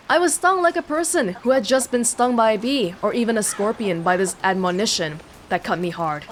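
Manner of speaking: ordinary talk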